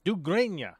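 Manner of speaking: "Duggregna" pitched high, as doo-grain-ya, falling; copying Swedish pronunciation. Shown as a demonstration. Learner repeating after a translator, high "Duggregna" as doo-grain-ya. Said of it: "I'm probably saying that right".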